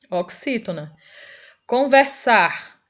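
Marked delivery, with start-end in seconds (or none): 1.7-2.8 "Conversar" drawn out slowly, stressed on SAR; final-syllable stress. Shown as a demonstration. Teacher giving an oxytone example, slow "Conversar" stressed on SAR. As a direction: stretch "Conversar" and land on sar. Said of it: "é a sílaba mais forte".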